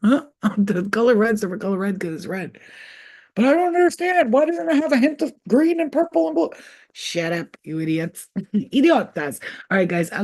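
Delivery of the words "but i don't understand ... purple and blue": high, loud whiny voice; mock whiny arguer. Mocking Facebook people arguing about red, high whine. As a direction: whine like a clueless poster.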